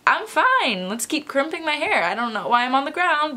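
acted high, carefree reassurance; retold chirpy denial; oblivious insistence. Insists she is fine after fainting.

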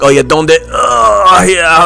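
mock toilet grunts between words; strained Spanish phone chat; straining Spanish speaker. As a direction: imitate a man pushing hard while on the phone. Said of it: "he was speaking Spanish, so he was like".

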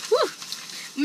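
high unvoiced blow of relief; breathy relieved whew. Real, not acted. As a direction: blow out the sourness. After sour spray.

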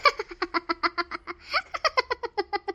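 long teasing cackle; mischievous laugh. Acted. Woman laughs after startling her neighbour, teasing cackle. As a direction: laugh at the fright you caused.

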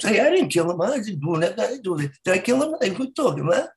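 mock Italian mobster denying everything; mobster impression. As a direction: protest like a cartoon mafia guy. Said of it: "they're so, uh, predictable sometimes".

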